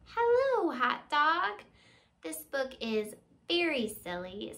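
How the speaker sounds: usual speaking voice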